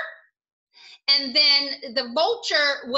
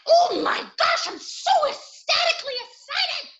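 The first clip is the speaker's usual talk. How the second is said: high, gushing excitement; hammed-up ecstatic squeal; 11 semitones higher than usual. Shown as a demonstration. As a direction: gush it high, overjoyed. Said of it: "And ecstatic".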